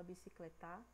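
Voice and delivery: bicicletar stressed on final TA; wrongly stressed bicicletar, bi-ci-cle-TÁ; stress on last syllable. Shown as a demonstration. Teacher showing what we don't say, bicicletar stressed at the end. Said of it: "A gente não fala".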